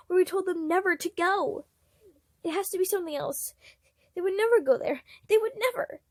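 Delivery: pleading that it can't be true; panicked. A narrator's reading. Patton panicking about the cave.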